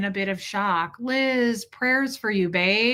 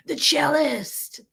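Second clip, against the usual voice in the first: drawn-out, higher mock announcement; mocking title; 5 semitones higher, much slower than usual. Mocking husband's nickname for himself, drawn out and high.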